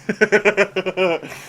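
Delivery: high, drawn-out laugh; laughter. Real, not acted. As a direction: burst out laughing at the story. Reader laughs at the story.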